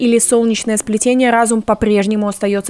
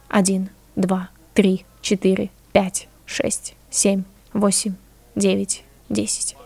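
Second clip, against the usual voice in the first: hushed slow count to ten; quiet referee count; 6 dB quieter, slower than usual. Acted. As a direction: count it out softly, fading to nothing. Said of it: "Тихий голос судьи". (тихий счёт судьи)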